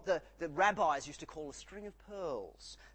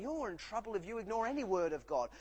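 usual speaking voice

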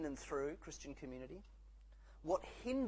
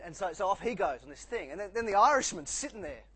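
usual speaking voice

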